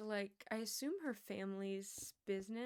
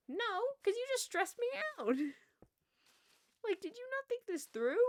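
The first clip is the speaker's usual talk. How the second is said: much higher and louder, exasperated telling-off; pissed rant; 12 semitones higher, 5 dB louder than usual. Acted. Ranting at the advertiser who stuck the ad on her car, high indignant yell. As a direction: scold them like they should have known better. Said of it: "I was so pissed".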